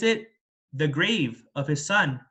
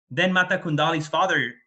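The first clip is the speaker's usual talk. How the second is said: ordinary delivery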